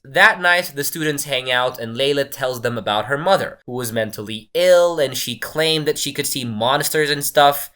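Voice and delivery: normal talking voice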